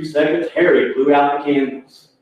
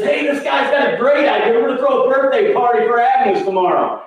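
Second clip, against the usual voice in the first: high, eager calling voice; excited news; 9 semitones higher than usual. Retold or imitated. Husband calls to his wife in the kitchen, imitated high excitement. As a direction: imitate passing on thrilling news, pitch way up.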